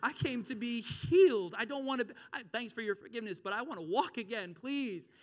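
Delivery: high, loud mock pleading; hammed-up exasperated appeal; imagined protest. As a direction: plead as the man who came to walk. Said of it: "If that was me".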